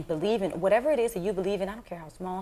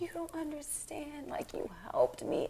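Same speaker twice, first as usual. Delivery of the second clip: slow, breathy whisper of a crying fan; tearful whisper; 10 semitones higher, 7 dB quieter than usual. Retold or imitated. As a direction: hush it out as if choked with tears. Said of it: "And was like".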